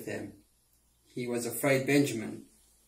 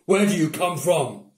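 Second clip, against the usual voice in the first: harsh, louder governor voice; mean; 4 semitones higher, 7 dB louder than usual. A narrator's reading. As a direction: bark the question at strangers. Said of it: "He spoke to them in a mean way".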